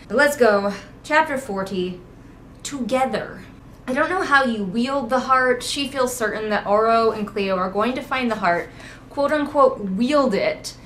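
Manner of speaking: ordinary talk, nothing marked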